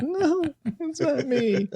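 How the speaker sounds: put-on high, slow, laughing little kid; whiny kid denial. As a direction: wail it like a guilty child. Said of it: "He's like".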